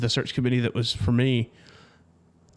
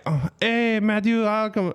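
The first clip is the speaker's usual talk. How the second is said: higher-pitched mock accent of the caller; a playful, admittedly terrible put-on accent, pitched up; imitating Jorge's accent; 8 semitones higher than usual. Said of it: "that's a terrible impersonation".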